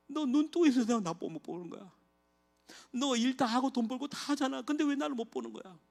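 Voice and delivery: high, tender pleading voice of God; God calling his blind children as a father. Retold or imitated. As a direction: plead gently like a hurt father. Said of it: "하나님이 우리한테".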